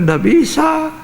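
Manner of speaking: mock stern pastor saying no; pastor refusing. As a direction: refuse flatly as the pastor, pitched high. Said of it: "Pendeta bilang".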